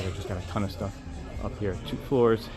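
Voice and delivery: usual speaking voice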